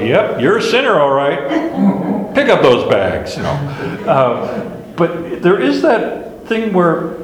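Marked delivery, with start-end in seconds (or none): 0.0-3.4 blunt dismissal. Retold or imitated. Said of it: "he looks at him and goes".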